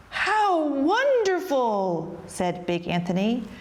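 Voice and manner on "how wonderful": high, long-drawn marvelling; awed delight. A narrator's reading. Big Anthony secretly watches the magic pot, amazed. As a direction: stretch it out in wide-eyed wonder.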